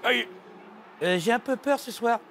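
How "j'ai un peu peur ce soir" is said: ordinary talk, nothing marked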